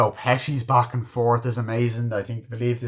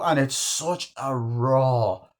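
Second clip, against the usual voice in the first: normal talking voice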